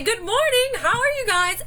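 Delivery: bright, high Southern hello to the room; cheery greeting. Retold or imitated. Teacher greeting colleagues in the lounge, cheery and high. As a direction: sing out a friendly good morning. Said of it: "and I'd say".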